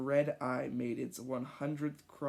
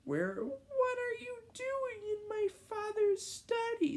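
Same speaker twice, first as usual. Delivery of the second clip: put-on much higher kid's voice, startled; little kid voice. Kid catches them in his father's study, high and startled. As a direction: squeak it like a surprised young boy. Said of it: "the kid said".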